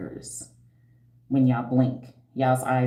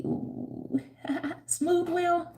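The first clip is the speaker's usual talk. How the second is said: flirty delight; 4 dB quieter, much slower than usual. Real, not acted. Flirting with commenter.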